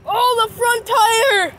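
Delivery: loud, slow cry of dismay; dismayed shout. Real, not acted. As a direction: shout it out as the tire sinks. Bike tire sinks into mud.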